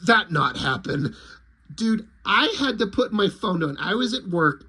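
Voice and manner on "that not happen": low flat-out alien denial; deadpan alien. Retold or imitated. Lizard rejecting Popo's tale, low deadpan. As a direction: lizard dismissing him, low and blunt. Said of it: "the lizard just goes".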